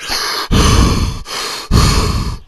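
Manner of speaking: a hammed-up, breathy sigh of exhaustion; imitated heavy breath. Mocking Chad, a winded self-proclaimed athlete, with a heavy sigh. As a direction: puff out air like a winded fake athlete. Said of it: "he's, like, out of breath".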